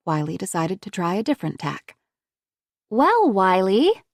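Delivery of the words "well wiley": put-on slow, sing-song little-girl voice; childish lilt. A narrator's reading. Woman playing a child for a silent boy, childish lilt. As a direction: drawl it like a small child. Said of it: "with a childish lilt to her voice".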